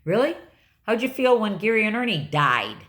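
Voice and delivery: retelling how she snapped at him; snapping back. Retold or imitated. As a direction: fire it back hard. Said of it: "And I snapped".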